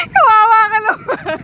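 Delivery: loud laughing pity shout; laughing mock pity. Real, not acted. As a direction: tease him with fake pity. Mocking the pranked boyfriend.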